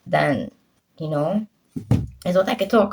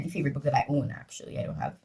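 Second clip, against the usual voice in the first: whispering; 5 semitones lower, 10 dB quieter, much faster than usual. Real, not acted. Aside whispered.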